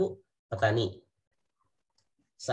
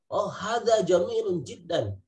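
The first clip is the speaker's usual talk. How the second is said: excited commentator marvelling at a goal, higher; football commentator; 5 semitones higher than usual. Retold or imitated. Teacher imitating an Arabic football commentator, excited. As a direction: cheer it like a match commentator.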